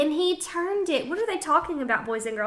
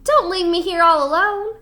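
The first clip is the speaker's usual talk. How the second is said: pleading; 5 semitones higher, 6 dB louder than usual. A narrator's reading. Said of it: "cried Sheep".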